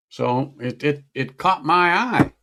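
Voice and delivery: usual speaking voice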